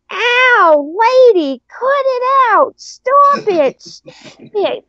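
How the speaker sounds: imitating a huge man's surprisingly high little voice; real high pitch. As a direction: squeal it like a Stooge getting punched. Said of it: "with this real high pitch".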